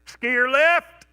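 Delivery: loud high shout down the slope; calling out. Retold or imitated. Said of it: "you're supposed to call out".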